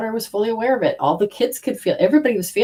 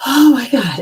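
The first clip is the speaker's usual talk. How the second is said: shocked exclamation; 6 semitones higher, 5 dB louder than usual. Real, not acted. Realising the scale.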